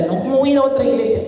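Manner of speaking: high, fast, alarmed objection; incredulous refusal. Retold or imitated. The man refusing the voice, fast and high. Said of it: "Y él decía".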